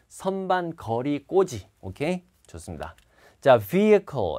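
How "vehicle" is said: vehicle as "vee-uh-kul", no h; silent H. Shown as a demonstration. Teacher modelling a TOEIC word, vehicle without H. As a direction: say vehicle with the h dropped. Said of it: "H 발음 안 합니다".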